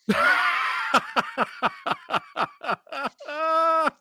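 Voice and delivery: long high wheezing laugh; prolonged uncontrollable cackle; real laughing fit. Real, not acted. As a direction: laugh helplessly in high, repeated bursts. Cracking up after the recap.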